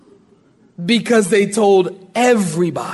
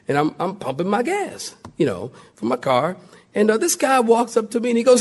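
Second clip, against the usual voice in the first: ordinary talk, nothing marked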